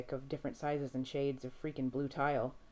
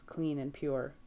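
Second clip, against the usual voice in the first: ordinary delivery, nothing marked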